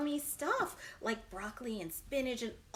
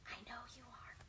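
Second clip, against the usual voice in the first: whisper; 3 semitones lower, 18 dB quieter, faster than usual. Real, not acted. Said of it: "whispered aside".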